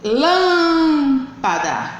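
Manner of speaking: "Lâmpada" with a very long LÂM; slow LÂÂÂM-pada stress demo; stressed syllable drawn out. Shown as a demonstration. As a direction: stretch the first syllable of "Lâmpada", then drop to PADA. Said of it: "sílaba mais forte da palavra".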